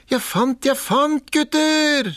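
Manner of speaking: very high, gleeful shout; excited call. A narrator's reading. Askeladden shouting his find to his brothers, very high. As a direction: crow it out with delight. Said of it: "ropte han".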